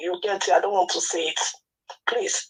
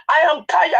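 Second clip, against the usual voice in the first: exasperated cry; 8 semitones higher, 4 dB louder than usual. Real, not acted. Upset caller, voice rising.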